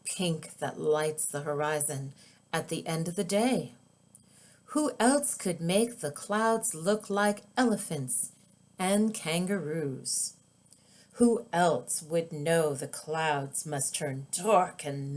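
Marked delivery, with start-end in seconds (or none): none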